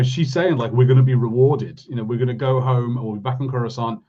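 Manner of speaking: ordinary talk, nothing marked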